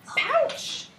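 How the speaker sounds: very high, excited storybook cry; excited exclamation. A narrator's reading. Teacher voices joey calling for mama's pouch, excited. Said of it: "Like, really excited and loud".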